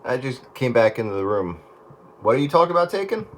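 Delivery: ordinary talk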